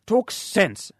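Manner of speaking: sharp. Acted. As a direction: cut him off sharply. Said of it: "said Doctor Keene sharply".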